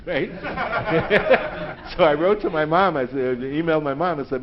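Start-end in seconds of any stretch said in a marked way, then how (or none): none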